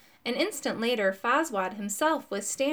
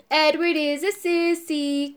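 slow, higher schoolyard taunt; sing-song taunt; 5 semitones higher, 3 dB louder than usual. A narrator's reading. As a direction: drawl it out like a bully kid. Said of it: "cried Peter".

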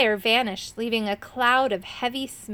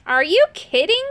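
loud, high incredulous croak; raised, rising storybook croak; 7 semitones higher, 6 dB louder than usual. A narrator's reading. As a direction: croak it out in disbelief. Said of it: "croaked Irk".

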